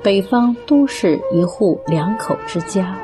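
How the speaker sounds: ordinary talk, nothing marked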